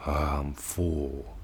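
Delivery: slow, soft, satisfied sigh; contented sigh. Shown as a demonstration. Teacher acting out feeling full, slow contented sigh. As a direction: sound sated and content. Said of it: "Even the word full".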